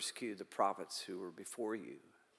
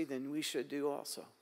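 ordinary delivery

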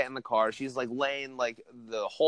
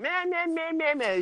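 much higher whiny man man man; mock bitching; 14 semitones higher than usual. Girlfriend griping back at her boyfriend, high whiny mimicry. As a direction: squeal it back like she is griping at him. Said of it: "she starts going".

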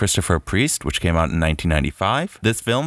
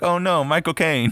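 mock dismay; 9 semitones higher than usual. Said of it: "I'd been like".

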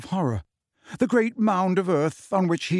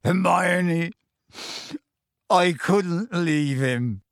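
slow, tearful Hagrid plea; 3 semitones higher, much slower than usual. A narrator's reading. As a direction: draw it out, fighting tears. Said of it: "tears now trickling down his bruised face".

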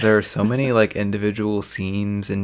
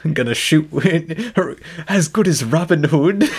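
high, far put-on stork voice; chirpy high stork imitation; 7 semitones higher than usual. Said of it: "his stork voice".

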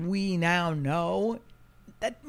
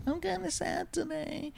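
small, high, soft mock-sad voice; imitating a pitiful self; sad little voice; 12 semitones higher, 7 dB quieter than usual. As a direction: squeak it out like a sad child. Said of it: "say, like".

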